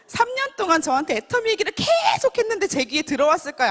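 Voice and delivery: ordinary talk, nothing marked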